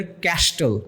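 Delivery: ক্যাস্টল with the t sounded, KASH-tol; ক্যাস্টল as two syllables, kash-TOL; student mispronunciation. Retold or imitated. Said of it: "আমাদের স্টুডেন্টরা উচ্চারণ করে ফেলে".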